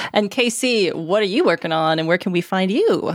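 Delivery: usual speaking voice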